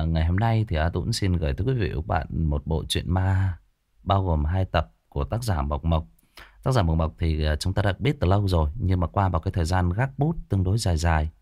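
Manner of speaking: ordinary delivery, nothing marked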